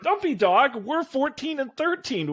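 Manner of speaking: put-on high, urgent young voice; kid protesting. [name] protests to the mascot in a high kid voice.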